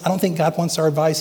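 usual speaking voice

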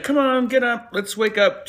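high, coaxing wake-up call; lightly voiced chipper husband; mock-bright rise and shine; cheery husband. Husband rousing his wife.

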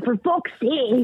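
put-on high, slow toddler voice with Scottish accent; little Scottish girl. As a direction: deliver the punchline as a Scottish-accented child. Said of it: "she has a little Scottish accent".

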